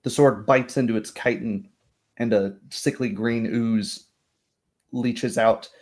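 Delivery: ordinary talk, nothing marked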